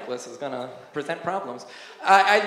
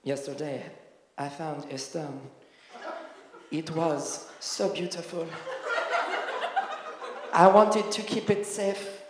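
put-on dreamy French accent, almost murmured; 4 dB quieter than usual. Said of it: "And one of them was like".

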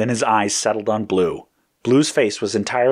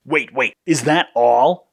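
slow, higher-pitched protest; 5 semitones higher, 5 dB louder, slower than usual. A narrator's reading. Red objecting to the computer's response, protesting. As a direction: object in drawn-out disbelief. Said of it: "Red protested".

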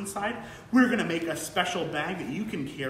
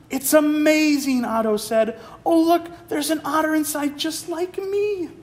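amazed otter; 10 semitones higher, 5 dB louder than usual. Acted. Otto marvels at the pearl.

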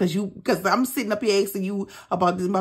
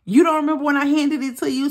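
mocking husband; 8 semitones higher, 4 dB louder, faster than usual. Quoting husband's claim, mocking.

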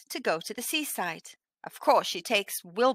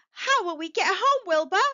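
a distressed plea to the cat; a mild, storybook panic; worried cry; 9 semitones higher than usual. Acted. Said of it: "cried Winnie".

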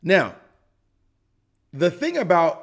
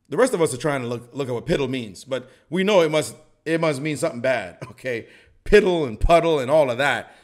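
normal talking voice

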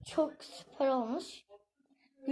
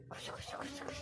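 whispering; 16 dB quieter than usual. Real, not acted. Running to dark room whispering.

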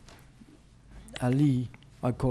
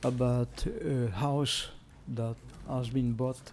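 trailing off softer and softer; fading quiet; 2 semitones lower, 11 dB quieter than usual. Real, not acted. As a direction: volume dropping away. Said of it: "I can't hear you".

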